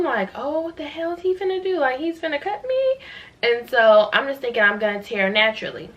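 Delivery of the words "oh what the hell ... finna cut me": high-pitched, incredulous panic; retold alarm. Patient sees doctor reach for scissors, high retold alarm. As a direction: recount your disbelief, pitch shooting up.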